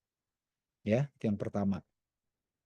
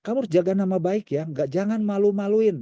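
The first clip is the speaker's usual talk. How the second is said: higher, louder parental warning; stern parent; 8 semitones higher, 4 dB louder than usual. Retold or imitated. Parent warning child not to embarrass them, stern. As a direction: scold the child about family honour.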